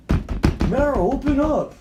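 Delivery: high, loud shout at a door; acted stranger's insistent shout; demanding call. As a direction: holler to be let in.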